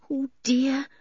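put-on high, anxious girl's voice; worried Anne. A narrator's reading. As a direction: fret to yourself. Anne worries while following boys.

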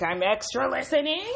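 high, loud, climbing eagerly to the end; hammed-up keen interest. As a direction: perk up as far as you can go. Escalated, even keener retold reply.

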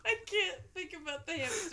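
high voice cracking with laughter; laughing to tears. Real, not acted. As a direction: barely get words out through laughter.

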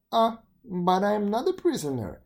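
high, loud teasing fly; a smug fly bragging; cheeky fly voice. Acted. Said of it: "observed the fly".

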